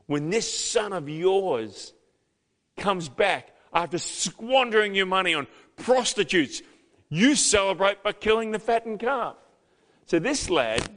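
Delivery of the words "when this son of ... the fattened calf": put-on resentful older son. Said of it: "See the relational distance there?".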